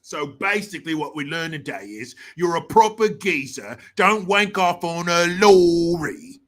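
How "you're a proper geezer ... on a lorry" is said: put-on broad Cockney accent, swelling louder; exaggerated put-on Cockney geezer accent; mock Cockney. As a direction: ham up the Cockney and stretch the ending. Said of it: "the accent's called Cockney".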